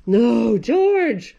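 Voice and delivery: exasperated mother voice; scolding mother. Acted.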